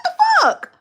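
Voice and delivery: very high, laughing what the fuck; incredulous squeal. Retold or imitated. Retelling the cashier's attitude, squealing in disbelief. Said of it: "I was like".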